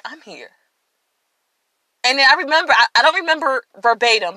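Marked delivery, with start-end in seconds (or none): 0.1-0.5 very soft, tender reassurance; barely above a breath, comforting; gently. Retold or imitated. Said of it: "God would just gently say".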